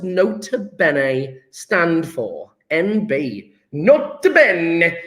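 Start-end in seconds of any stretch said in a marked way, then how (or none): 3.7-5.0 slow, drawn-out Italian accent; hammed-up Italian accent. Shown as a demonstration. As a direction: roll it out slowly as a mock Italian. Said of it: "say it with an Italian accent".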